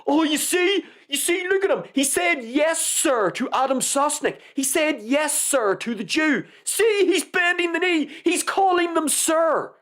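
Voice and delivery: high-pitched, hammed-up tattletale voice; mocking online critics. Mimicking people online accusing the guest, high mocking voice. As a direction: point out the supposed offence in a silly high voice. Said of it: "people online saying".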